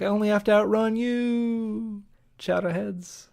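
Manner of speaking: high, drawn-out taunting call; mock yell back. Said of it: "Bill yells back".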